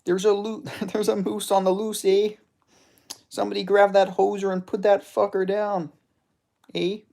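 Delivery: high-pitched cartoon Canadian with a laugh; Canadian accent. Streamer jokes about a moose on the loose, mock Canadian accent. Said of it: "always add A at the end".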